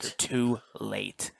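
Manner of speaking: drawn out at half speed; mock-dramatic line. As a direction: stretch each word for mock drama. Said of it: "He goes".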